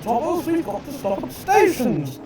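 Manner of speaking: high-pitched carriage ladies calling out; high ladylike call to hurry along; nagging coaches. A narrator's reading. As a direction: chide in a high, fussy voice.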